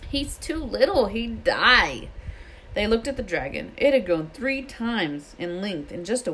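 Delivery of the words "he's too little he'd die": plaintive protest. A narrator's reading. Hagrid pleads for baby dragon.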